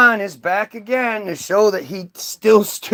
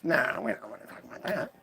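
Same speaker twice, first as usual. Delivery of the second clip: dismissive mumble; 9 semitones lower, 16 dB quieter, faster than usual. Retold or imitated. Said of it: "people would be like".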